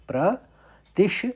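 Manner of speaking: प्रतिश said very slowly, syllable by syllable; slow syllable split. Shown as a demonstration. Teacher slowly splitting the word for students. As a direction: drag out प्रतिश to show the श sound. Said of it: "शौ का लेंगे".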